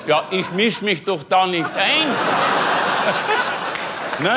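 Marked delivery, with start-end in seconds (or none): 2.1-4.2 long, very high airy laugh; high breathy laugh. Real, not acted. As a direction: squeal with silent laughter. Laughs at own joke. (langes, sehr hohes, hauchiges Lachen)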